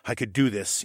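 usual speaking voice

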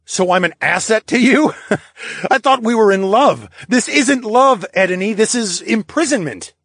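high, loud, wounded protest; indignant outburst. Acted. Charles accusing Edney of imprisonment, indignant outburst. Protagonist in acted lovers' quarrel.

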